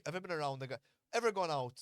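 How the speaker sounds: ordinary talk, nothing marked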